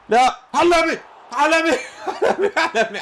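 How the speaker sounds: high laughing shout of praise; ecstatic cheering. Real, not acted. Goalkeeper save celebration.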